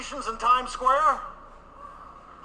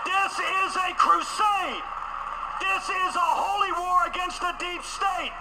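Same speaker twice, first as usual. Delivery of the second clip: rally the crowd to holy war; shouted like a fiery sermon; fervent shout; 6 semitones higher than usual. Real, not acted. Rallying crusade cry.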